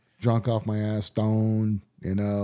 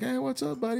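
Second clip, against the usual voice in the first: put-on much higher, soft doggy baby talk; 4 dB quieter than usual. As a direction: coo at the dog on your lap. Greeting the dog Lou Dog.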